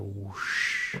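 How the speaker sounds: whoosh with a drawn-out sh; imitated whoosh. Shown as a demonstration. Musician describing the accent swish sound, imitated whoosh. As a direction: make a swishing sound effect.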